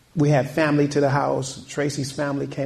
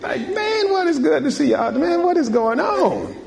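much higher, hammed-up cousin hollering hello; rowdy relatives greeting. Pastor mimicking his wife's rowdy family greeting him, hammed up. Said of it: "her crazy side of the family".

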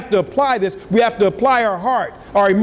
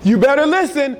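high, insistent warning; crying out; 6 semitones higher than usual. Acted. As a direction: call out a pitched-up warning. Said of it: "It's crying out".

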